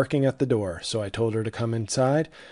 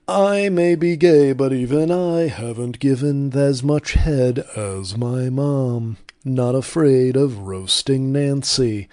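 Ron Reagan Junior; 5 dB louder, slower than usual. Retold or imitated. Host roasting a celebrity in a loud slow mock voice. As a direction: ham up a smug celebrity confession. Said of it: "Ron Reagan Junior with an early appearance".

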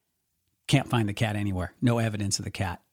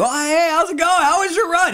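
high, loud teammate's welcome; cheery mock greeting; 6 dB louder than usual. Teammate waiting at the relay exchange, high cheery greeting. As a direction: call out eagerly to an arriving runner.

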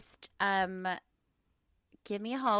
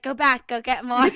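loud, higher, playful urging; mock urgent command; 4 semitones higher, 13 dB louder than usual. Urging a friend back for free pastry, loud mock order. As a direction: send a friend back, much louder.

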